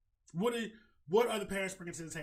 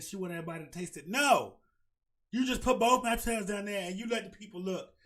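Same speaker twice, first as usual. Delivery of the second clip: ordinary delivery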